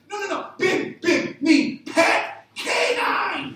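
high, loud exasperated yell of the clues; mock yelling. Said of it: "Stop yelling the same words at me".

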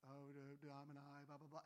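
low, soft mock Latin chant; mock priest Latin. Mimicking a priest heard chanting Latin, lightly done. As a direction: mumble a bit of priest Latin. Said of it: "a priest in Latin talking".

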